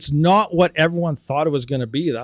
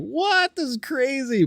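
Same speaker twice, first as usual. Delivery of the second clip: high-pitched, drawn-out disbelief; exaggerated retold shock, pitched way up; mock astonishment; 10 semitones higher, slower than usual. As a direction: replay your amazement in a much higher voice. Retells own amazed reaction.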